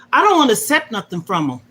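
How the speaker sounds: ordinary talk, nothing marked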